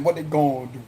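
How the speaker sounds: "gonna" cut down to "gon"; casual Cajun "gonna" as "gon". Shown as a demonstration. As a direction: say "gonna" as one syllable, "gon". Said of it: "G O N".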